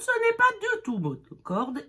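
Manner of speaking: high, swooping exaggerated melody; not monotone. Shown as a demonstration. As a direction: make the pitch leap about theatrically. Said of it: "Quand on parle anglais".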